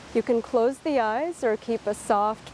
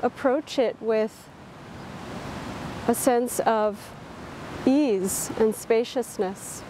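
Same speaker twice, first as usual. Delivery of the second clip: unhurried, calm body-scan voice; guided meditation. Real, not acted. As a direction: guide them gently through a body scan, leaving space between phrases.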